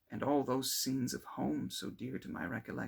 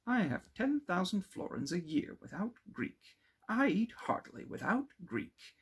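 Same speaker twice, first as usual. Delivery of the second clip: put-on much higher, comic stubborn old man; mock Dutch schoolmaster; 10 semitones higher, 4 dB louder than usual. Clerval mocking his father's stubborn refusal, comic voice. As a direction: lampoon a blunt, self-satisfied burgher. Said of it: "that of a Dutch schoolmaster".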